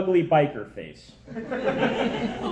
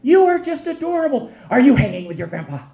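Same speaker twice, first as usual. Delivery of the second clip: put-on high gushing woman's voice, cooing over a baby; gushing lady; 6 semitones higher than usual. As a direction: coo it up high like a doting stranger. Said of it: "I hear this lady go".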